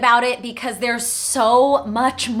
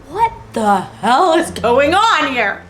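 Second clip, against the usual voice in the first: slow, higher mock outburst; stunned disbelief; 4 semitones higher, 4 dB louder, slower than usual. Job seeker seeing Paramount address, slow astonished outburst. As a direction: draw it out, astonished.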